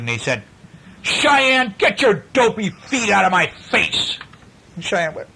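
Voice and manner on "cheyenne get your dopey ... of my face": put-on high, cross boy's complaint; grumpy boy. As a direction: snap at your sister from the bottom bunk. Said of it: "Hunter was not in a very good mood".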